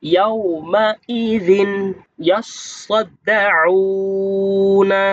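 slow melodic tajweed chant; Quranic recitation. Shown as a demonstration. Teacher recites the verse slowly for students to copy. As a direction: intone each word long and steady.

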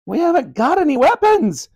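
put-on high, loud boyish shout. A narrator's reading. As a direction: yell up at the helicopter. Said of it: "shouted Ben".

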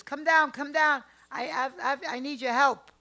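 calling for help. Retold or imitated. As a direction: call up to the neighbour, stammering with urgency. Grandmother calls up the stairs.

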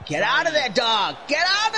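high yelled get out, imitated; mock shouted command. Imitating the commentator yelling at the dog, high mock shout. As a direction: bellow it like a commentator urging. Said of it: "he kept going".

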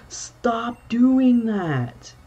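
very high, loud, very slow stop; drawn-out exasperated yell. Real, not acted. Player begging the enemy to stop, drawn-out yell. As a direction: drag it out in frustration.

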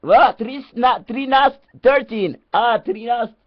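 high, loud cartoonish teacher voice, swearing at the class; squeaky put-on teacher ranting; mock English teacher. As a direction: play a deranged teacher shrieking at his pupils. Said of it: "This is your first day in the English school lesson".

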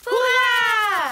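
loud joyful shout; excited cheer. Acted. Cheering at the recipe.

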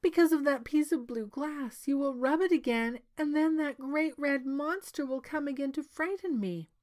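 high, loud anxious princess; worked-up princess pleading her fear; frightened princess. A narrator's reading. Princess fears the monster's return.

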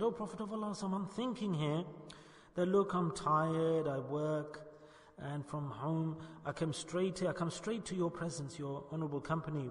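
quiet, humble plea; soft confiding. Acted. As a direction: confide it softly to a beloved teacher. Thawban confides his longing.

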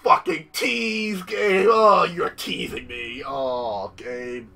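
slow, drawn-out theatrical whine; hammed-up hurt; mock whine. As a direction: pout at the game. Whining at game.

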